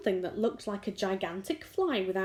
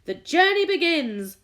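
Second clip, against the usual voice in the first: high, loud announcement; triumphant shout; 8 semitones higher, 9 dB louder than usual. A narrator's reading. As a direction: proclaim it joyfully. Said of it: "shouted the centipede".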